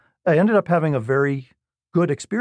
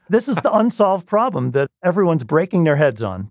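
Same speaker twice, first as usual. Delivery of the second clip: incredulous scolding; 5 semitones higher than usual. Retold or imitated. Professor scolding the cocky student.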